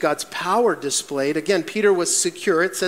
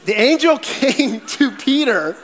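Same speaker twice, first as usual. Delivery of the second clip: laughing through it; 7 semitones higher, 4 dB louder, slower than usual. Real, not acted. Laughing at the dad joke.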